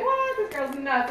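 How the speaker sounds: squeaky high "what"; mock shock. Store clerk baffled by the coupon offer, mock shock. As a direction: play the baffled stranger. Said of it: "And they're like".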